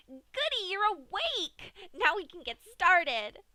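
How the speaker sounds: very high and loud, slow and sing-song; gleeful Pinkie. A narrator's reading. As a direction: chirp it over her captive. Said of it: "Pinkie stated gleefully".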